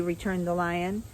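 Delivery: ordinary delivery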